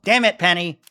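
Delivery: angry outburst. Acted. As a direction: snap at Penny in exasperation. Outburst at Penny.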